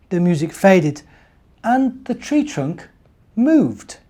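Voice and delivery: lively bedtime story read; story narration. A narrator's reading. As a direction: read it warmly to small children. Reading story aloud to children.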